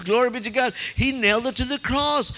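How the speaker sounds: ordinary talk, nothing marked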